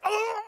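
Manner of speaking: high mock "oh" of alarm; imitating startled neighbor. Neighbor slipping on the fence, high mock cry of alarm. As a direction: cry out as if losing balance.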